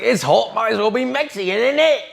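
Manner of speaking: high-pitched imitation of an English woman; mock English accent. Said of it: "speak English like this".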